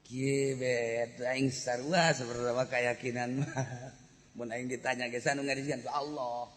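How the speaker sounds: usual speaking voice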